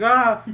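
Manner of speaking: short loud high shout of "ah"; panicked yell. Retold or imitated. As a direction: jolt awake and yell in panic. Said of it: "I yelled, I was like".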